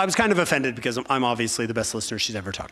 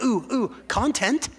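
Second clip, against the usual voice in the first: higher, sing-song teasing of her husband; wife's teasing voice; 8 semitones higher than usual. Retold or imitated. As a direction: tease him like he's almost there. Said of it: "She's like".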